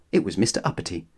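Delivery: ordinary delivery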